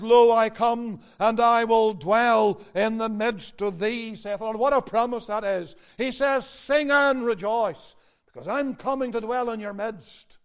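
loud, ringing scripture proclamation; impassioned preaching. Real, not acted. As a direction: proclaim the promise to the congregation. Preaches a promise with force.

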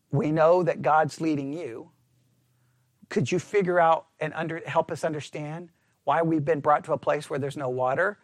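low, soft, respectful asking; gently ask, not accuse; humble questioning. Retold or imitated. The people humbly asking Moses, soft and low. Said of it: "that's a humbling, questioning".

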